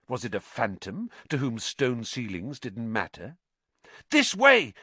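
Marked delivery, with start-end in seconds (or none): 4.1-4.7 high, loud call to follow; urgent shout. A narrator's reading. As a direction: shout them onward. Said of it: "he shouted".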